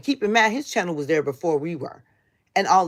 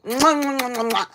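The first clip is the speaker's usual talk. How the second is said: blown kisses; 7 semitones higher, faster than usual. Real, not acted. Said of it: "I love you".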